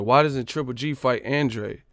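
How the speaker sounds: ordinary talk, nothing marked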